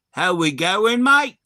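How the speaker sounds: loud, high exaggerated Aussie twang; hammed-up Australian greeting, pitch leaping up on the last word; mock the Aussie greeting at full volume; mock Aussie accent. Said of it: "And I was like".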